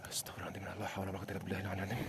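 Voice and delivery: hushed rushed prayer; frightened whispered prayer. Real, not acted. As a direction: whisper the prayer fast in fear. Candle fell, frightened praying.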